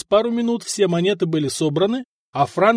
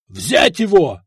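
loud, abrupt command; barked order; 5 dB louder, slower than usual. A narrator's reading. Duke ordering his men to seize Vyshata, barking. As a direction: bark it at your soldiers. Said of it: "рявкнул герцог".

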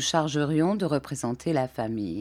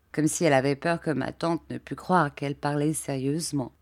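plain reading voice

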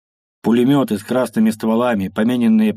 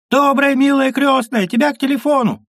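yelling. Acted. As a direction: shriek it across the battlefield. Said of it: "и орёт".

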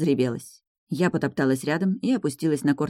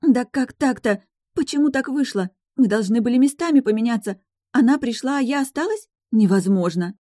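high, agitated wail of disbelief; despairing, high-pitched girl; desperate lament; 7 semitones higher, 3 dB louder than usual. A narrator's reading. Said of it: "в отчаянии колотила ... и шёпотом причитала".

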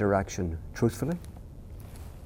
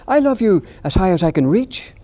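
high, louder eager little voice; little hare reaching; 10 semitones higher, 7 dB louder than usual. Acted. Little hare reaching up to beat his father, high eager. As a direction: stretch up with all your might. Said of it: "said Little Nut Brown Hare".

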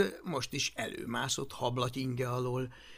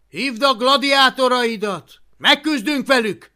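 loud, high challenge; défi lancé fort; defiant call; 10 semitones higher, 15 dB louder than usual. A narrator's reading. Hiccup challenging Alvin.

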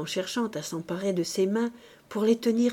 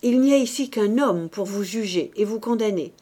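plain reading voice

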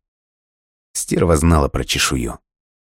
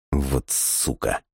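slow, much softer angry inner curse; angry thought; 3 semitones lower, 9 dB quieter, much slower than usual. A narrator's reading. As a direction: spit it out under the breath, slowly. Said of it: "зло подумал Лесков". (злая мысль про себя, медленно и тихо)

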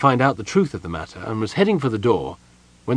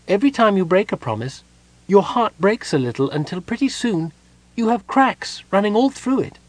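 a light kender voice turned solemn; serious kender; 10 semitones higher, 3 dB louder than usual. A narrator's reading.

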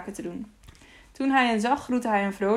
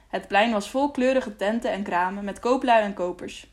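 ordinary delivery, nothing marked

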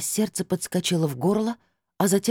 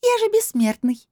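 very high, taunting crystal voice; silvery amused voice; 5 dB louder than usual. A narrator's reading. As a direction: tease from high up, invulnerable. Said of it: "засмеялся серебристый голос". (высоким серебристым голосом, насмешливо)

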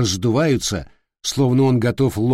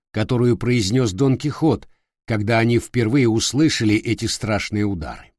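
plain reading voice

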